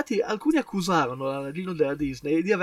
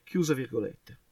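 normal talking voice

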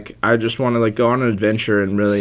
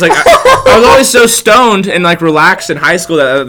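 laughing while telling; 7 semitones higher, 9 dB louder than usual. Real, not acted. Laughs recalling his stoned nickname.

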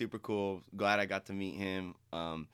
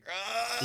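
high, strained mock groan; imitating someone's frustrated "ugh"; imitated exasperated groan; 11 semitones higher than usual. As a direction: groan like a brain short-circuiting. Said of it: "they're going".

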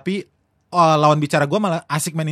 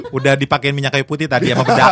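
ordinary talk, nothing marked

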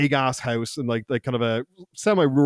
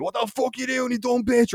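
retold angry outburst; 8 semitones higher, 3 dB quieter than usual. Host snapping at girl who knocked over vase, mock fury. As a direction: imitate someone losing it in a strained high voice. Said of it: "he loses his shit".

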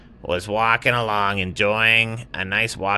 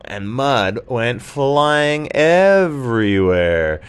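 slow, louder, drawn-out storybook build; dramatic narration; 5 semitones higher, 6 dB louder, much slower than usual. A narrator's reading. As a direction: make the mud splash big for the listener. Narrator's big moment in story.

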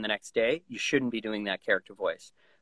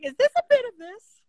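high questioning voice; 7 dB louder than usual. Retold or imitated. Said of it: "'Cause they're like".

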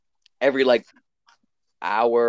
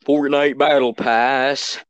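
slow announcer voice; 4 dB louder, much slower than usual. Pretend. Random announcement.

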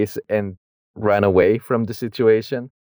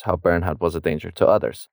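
usual speaking voice

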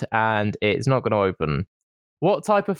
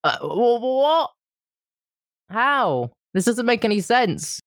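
morphing voice, higher than usual; higher pitched voice; 2 semitones higher than usual. Real, not acted. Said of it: "You sound so much higher pitch".

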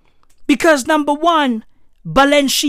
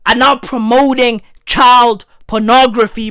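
normal talking voice

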